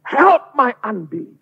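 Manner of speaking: slow, loud, high plea; desperate cry. Acted. Father begging Jesus for faith, slow loud cry. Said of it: "Dia teriak".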